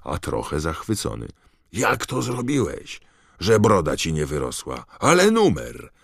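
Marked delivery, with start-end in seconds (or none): 1.7-5.9 high, loud excited shout. Acted. George shouting at Harry in the common room, thrilled. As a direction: yell it out in sheer delight. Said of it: "krzyknął George".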